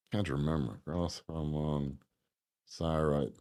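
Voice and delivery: low, very soft, trailing murmur; muttering to himself. Real, not acted. Host searching for a forgotten fact, muttering to himself. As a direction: think aloud under your breath while looking something up.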